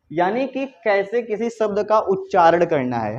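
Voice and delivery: ordinary talk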